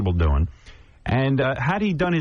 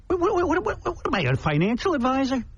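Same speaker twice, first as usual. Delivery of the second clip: exasperated rant; 12 semitones higher than usual. Real, not acted. Ranting at the fan's question.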